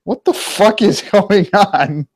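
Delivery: high, slow startled voice; baffled outburst. Retold or imitated. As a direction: act stunned and confused. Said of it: "He's like".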